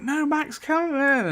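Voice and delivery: a high plea for Max to come inside; mock pleading. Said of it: "Addie's like".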